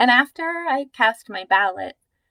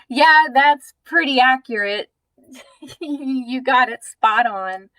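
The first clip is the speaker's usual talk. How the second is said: louder, good-humored agreement with a laugh; amused reply; 4 semitones higher, 8 dB louder than usual. Retold or imitated. Speaker answers the man who called her hippie, laughing agreement. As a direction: retell it laughing, cheerfully owning the label. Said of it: "And I kinda laugh".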